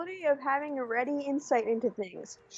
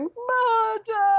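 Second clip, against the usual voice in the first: high, hammy elf shout; Oblivion high elf voice; 9 semitones higher, 4 dB louder than usual. Pretend. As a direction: cry it like a panicked elf. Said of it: "with an Oblivion high elf voice going".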